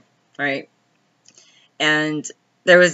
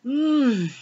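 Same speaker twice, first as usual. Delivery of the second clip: mid-yawn hum; 8 semitones higher, 5 dB quieter than usual. Real, not acted. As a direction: let a yawn stretch it out. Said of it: "sorry, I keep yawning".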